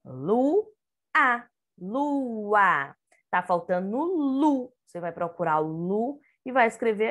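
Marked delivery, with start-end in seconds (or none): 0.0-3.0 LUA broken in two, LU, pause, A; teacher sounding out Lua slowly; split into syllables. Shown as a demonstration. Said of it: "Aqui nós vamos completar".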